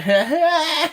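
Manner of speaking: high, hollow mock laugh; despairing laugh. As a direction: laugh like you want to die. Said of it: "I'm about to kill myself" laugh".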